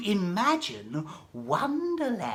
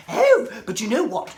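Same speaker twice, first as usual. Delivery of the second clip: plain reading voice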